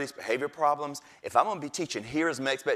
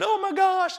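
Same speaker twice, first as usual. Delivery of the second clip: much higher, wailing exclamation; distressed colleague; 5 dB louder than usual. Retold or imitated. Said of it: "She said".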